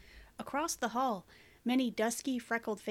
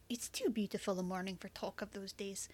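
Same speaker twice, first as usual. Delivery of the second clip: whispering; 7 dB quieter than usual. Acted. Tender bedside talk, hushed.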